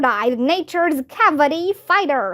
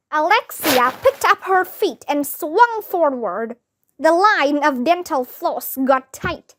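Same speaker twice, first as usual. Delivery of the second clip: plain reading voice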